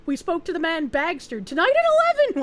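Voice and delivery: hammed-up breaking-news anchor; mock news read. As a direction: announce it like a tabloid headline. Mock news headline about herself.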